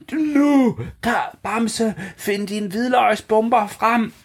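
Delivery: high urgent shout, last line slow; shouting Kaj. Acted. Kaj shouting to Bamse while fleeing, high and urgent. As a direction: call out in a high voice while running. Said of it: "råber Kaj til Bamse".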